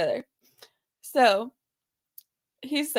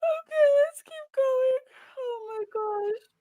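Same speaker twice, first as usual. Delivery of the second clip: high, wheezy, struggling to recover; trying to go on but still cracking up; laughing; 11 semitones higher, 3 dB quieter than usual. Real, not acted. Trying to continue while laughing.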